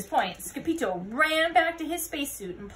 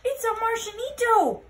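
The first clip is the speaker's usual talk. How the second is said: high, loud, thrilled announcement; excited cry; 10 semitones higher, 7 dB louder than usual. Acted. Scapito crying out on spotting the alien. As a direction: shout it out in wonder.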